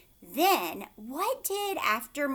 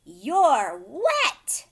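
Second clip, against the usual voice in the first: loud scolding cry; a hammed-up upset mom at the door; mama howls; 3 semitones higher, 13 dB louder than usual. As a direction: howl it at the dripping kids. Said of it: "Mama howls".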